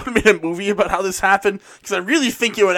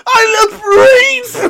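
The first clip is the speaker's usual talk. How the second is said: high, loud, drawn-out comic scream; hammed-up mad surgeon's yell; mock screaming; 14 semitones higher, 8 dB louder, much slower than usual. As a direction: scream it slowly like a brain-crazed maniac. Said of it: "Egas Monas is just screaming".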